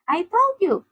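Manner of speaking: high-pitched told-you-so; triumphant cry. A narrator's reading. Zoro proved right in the argument, high triumphant cry. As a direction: crow over being proved right. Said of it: "Zoro suddenly cried".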